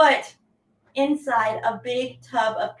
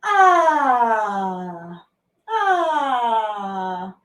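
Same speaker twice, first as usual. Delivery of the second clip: louder drawn-out ah; stretching ah; 2 semitones lower, 4 dB louder than usual. Shown as a demonstration. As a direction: sigh out an ah into the stretch.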